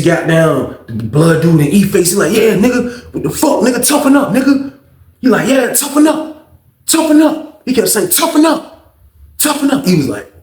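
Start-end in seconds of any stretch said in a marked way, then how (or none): 2.4-4.8 put-on high, laughing taunt of a drunk bully; mocking taunt. Mocking taunt in prison story. 5.5-7.6 put-on high, laughing taunt of a drunk bully; mocking taunt. 8.1-9.9 put-on high, laughing taunt of a drunk bully; mocking taunt.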